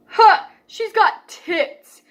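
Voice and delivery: slow, high, loud leering voice; lightly imitated bully; mocking bully voice. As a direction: drag it out like a jeering kid. Retelling a bully's crude remark.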